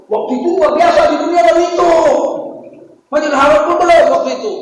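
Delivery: high, loud and fast; excited shout. Real, not acted. Preacher to congregation, marveling at the mosque: excited shout. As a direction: proclaim the marvel to the crowd.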